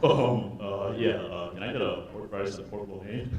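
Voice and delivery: low lazy dude drawl. Retold or imitated. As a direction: mumble like a bored guy.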